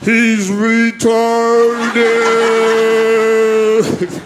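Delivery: words stretched out very slowly; pleading with the thugs in mock slow motion; slow-motion plea. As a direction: drag it out like a slow-motion replay.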